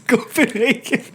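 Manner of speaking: laughing echo. Real, not acted.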